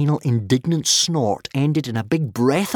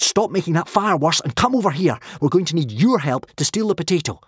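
ordering; 7 semitones higher, 4 dB louder than usual. A narrator's reading. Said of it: "Hiccup ordered".

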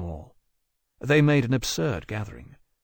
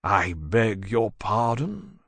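frigidly aristocratic; much slower than usual. Acted. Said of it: "in his most frigidly aristocratic accents".

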